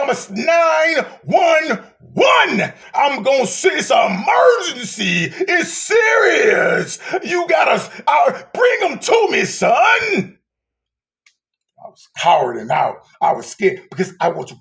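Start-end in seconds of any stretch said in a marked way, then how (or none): 0.0-10.4 urgent shouted call. Acted. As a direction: cry it out like an emergency. God's urgent call, preacher shouting.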